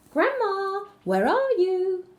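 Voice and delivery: high calling shout, slow and loud; shouting girl. A narrator's reading. Girl calls loudly for grandma entering the house, high shout. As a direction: call out through the house for grandma.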